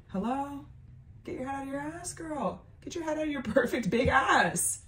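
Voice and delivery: condescending scolding. Retold or imitated. Scolds friend for not knowing.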